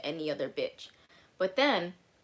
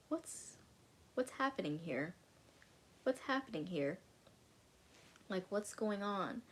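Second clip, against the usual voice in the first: much softer, stunned; bewildered hush; 9 dB quieter than usual. Retold or imitated. Answering his crying FaceTime call, hushed and bewildered. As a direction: barely breathe the question out.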